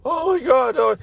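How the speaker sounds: high-pitched mock dismay; retold panic. Boss hearing worker will report her, imitated panic.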